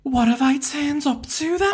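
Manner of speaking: high-pitched Scouse, playfully indignant; Scouse accent. Acted. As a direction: squeal the question in put-on Scouse. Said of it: "when you do your Scouse accent".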